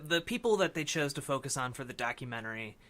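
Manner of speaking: normal talking voice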